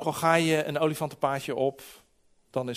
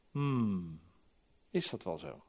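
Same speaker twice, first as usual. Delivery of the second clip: low, soft, doubtful musing; sceptical pondering; 8 semitones lower, 7 dB quieter, slower than usual. Acted. Listener sniffing out a wolf's words, low sceptical murmur. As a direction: hum low and question it quietly. Acted doubtful reaction to false preaching.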